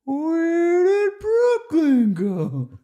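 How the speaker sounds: very high, loud cartoon voice, dragged slowly at the end; Snooki impression. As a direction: squeak it out like the puppet. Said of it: "the inflection's excellent".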